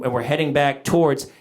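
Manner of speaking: normal talking voice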